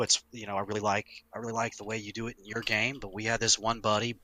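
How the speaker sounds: normal talking voice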